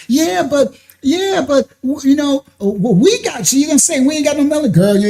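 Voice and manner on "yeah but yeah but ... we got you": higher, flustered mock girlfriend; lightly voiced fumbling excuse; stammering excuse.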